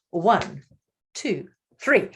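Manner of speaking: usual speaking voice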